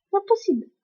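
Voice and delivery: you cannot believe it; disbelief. Real, not acted. Player reacting to the game, high disbelieving cry.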